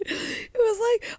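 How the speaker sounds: ordinary talk, nothing marked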